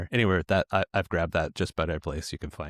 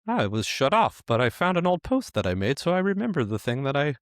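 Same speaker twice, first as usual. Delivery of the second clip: higher lobster creature voice, lightly voiced; 6 semitones higher than usual. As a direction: talk like a little AI lobster. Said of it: "That was my lobster voice too".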